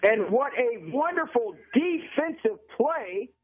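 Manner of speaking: radio football call, pitched above casual chat; live play-by-play. Real, not acted. Announcer calls a defensive play and punt to listeners, live play-by-play. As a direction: call the game live, stretching the key words.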